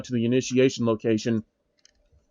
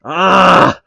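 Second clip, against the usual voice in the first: angry yell; 5 semitones higher, 8 dB louder than usual. Acted. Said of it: "It was the sound of anger".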